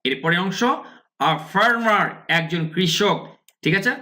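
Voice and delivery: ordinary talk, nothing marked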